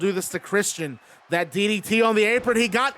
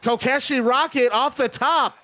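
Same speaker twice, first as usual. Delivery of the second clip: wrestling commentator; 6 semitones higher than usual. Real, not acted. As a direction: stretch out the big dive call. Dive off the top, big call.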